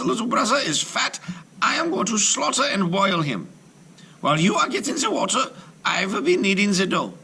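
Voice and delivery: high, bossy crone voice; cruel witch. Acted. Witch ordering Gretel to fill the cauldron, high and bossy. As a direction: give orders like a wicked old witch. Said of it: "the old woman said to Gretel".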